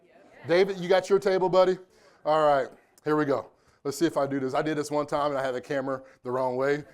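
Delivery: normal talking voice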